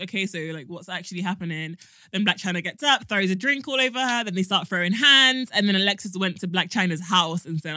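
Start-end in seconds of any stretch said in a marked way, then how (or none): 0.0-1.8 soft, low puzzled quote; understated, low-key questioning; calm retold reaction. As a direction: imitate a quietly baffled reply. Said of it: "she's like".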